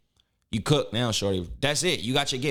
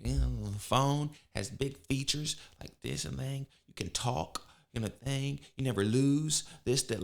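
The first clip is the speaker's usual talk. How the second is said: mumbling saleswoman; 7 dB quieter than usual. Pretend. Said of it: "she was just".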